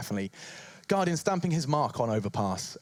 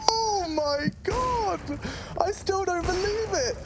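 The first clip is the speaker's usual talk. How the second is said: stunned shout; much slower than usual. Real, not acted. Caster stunned after ace.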